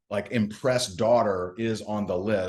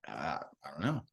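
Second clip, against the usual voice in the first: much softer and lower, mumbled; mumbled shrug; 6 semitones lower, 11 dB quieter than usual. Retold or imitated. Prospect admitting he has no plan, mumbled low and soft. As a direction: mumble it like you have no idea. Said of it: "his answer was".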